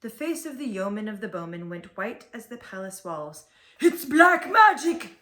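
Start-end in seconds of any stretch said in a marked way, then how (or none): 3.8-5.2 high, loud, slow terrified shriek. A narrator's reading. The yeoman sees the hat return and shrieks in terror, high and loud. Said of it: "he shrieked".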